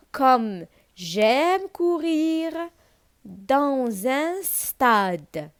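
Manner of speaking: very slow, drawn-out model reading; poem recitation. Shown as a demonstration. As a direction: start high and stretch each word. Reciting poem line for pupils. (قراية الشعر بشوية وبتمطيط)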